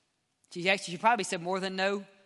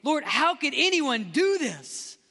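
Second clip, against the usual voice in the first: high, slow cry of outrage to God; re-enacted angry prayer; 7 semitones higher, 3 dB louder, slower than usual. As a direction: protest in anger, pitch raised, words drawn out. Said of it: "I would get so angry".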